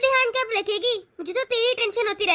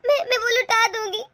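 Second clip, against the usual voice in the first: ordinary talk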